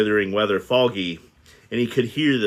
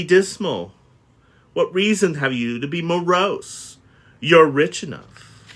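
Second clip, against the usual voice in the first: lightly voiced reproach; 5 semitones higher, 4 dB louder than usual. Nephew's reproachful questions.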